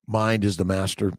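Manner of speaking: ordinary delivery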